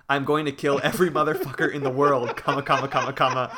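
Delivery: half-laughing mock tirade; mock all-caps rant. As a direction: read an angry post aloud, breaking into laughter. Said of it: "he says in all caps".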